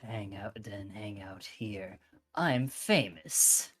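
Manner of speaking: lightly voiced aloof pop star, slow and dropping to a whisper; a half-hearted try at a smug idol; poor Yoru impression. Streamer voicing Yoru for the dating game, badly done smug idol. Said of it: "I am trying to do Yoru, and I think I'm doing it poorly".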